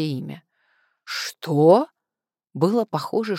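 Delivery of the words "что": loud, incredulous question; utterly stunned. A narrator's reading. Caller floored by the name she blurted, stunned. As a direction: blurt it in disbelief. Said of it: "мужик в телефоне ошарашен до предела".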